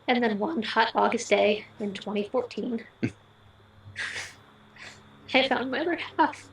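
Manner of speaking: usual speaking voice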